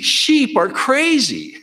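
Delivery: high, drawn-out exclamation; comic exclamation. Real, not acted. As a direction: let it leap up high and slow, amused at the madness. Comic exclamation about bouncing sheep.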